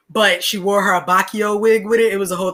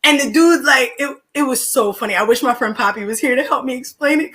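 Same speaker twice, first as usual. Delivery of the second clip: ordinary talk, nothing marked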